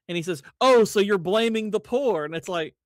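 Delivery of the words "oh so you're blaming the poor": loud, drawn-out mock gotcha; mock accusation. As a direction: pounce on it like a smug accuser, slowly. Mocking retold debate accusation.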